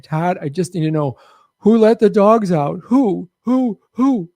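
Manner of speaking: milk fake tears on every who; hammed-up tearful begging; tearful mock plea. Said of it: "I got myself in the point of tears".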